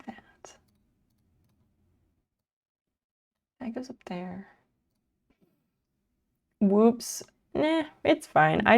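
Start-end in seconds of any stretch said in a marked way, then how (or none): 0.1-4.5 whisper. Real, not acted. Whispering to herself.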